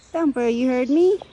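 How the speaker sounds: high, loud sing-song call; calling the bunny. Real, not acted. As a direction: call out to the bunny hiding nearby.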